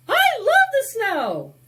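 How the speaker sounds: high and loud delight; excited. Acted. Pedro telling Sancho he loves the snow, high and joyful. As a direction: burst out with joy.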